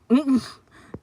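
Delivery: sudden loud high laugh; burst of laughter. Real, not acted. Reading a funny comment to viewers, a loud high laugh. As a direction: cackle out at the comment.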